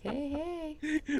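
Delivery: high, soft put-on "hey"; pretend-sly little hello; playful mock greeting. Acts out a flirty online greeting.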